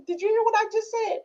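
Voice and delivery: high-pitched acted check on a listener; mock questioning.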